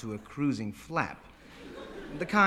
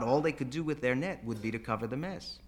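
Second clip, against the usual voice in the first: ordinary delivery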